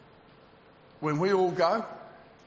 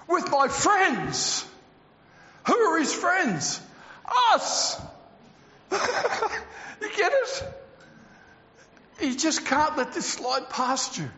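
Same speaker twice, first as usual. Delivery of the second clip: high-pitched, excited pulpit delivery; fervent preaching; 9 semitones higher than usual. Acted. Preacher tells the congregation of the rapture, fervent preaching. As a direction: preach it with rising zeal and drawn-out key words.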